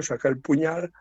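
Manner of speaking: normal talking voice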